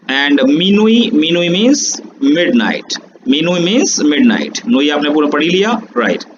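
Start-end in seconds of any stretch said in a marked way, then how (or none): 0.1-4.7 slow teaching. Shown as a demonstration. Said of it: "teaching French words".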